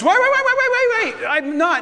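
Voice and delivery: high, loud rapid-fire repeats; hammed-up panicked stop call; frantic interruption. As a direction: cut in urgently as if halting someone mid-act. Acting out God stopping Abraham.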